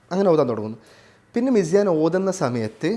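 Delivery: ordinary talk, nothing marked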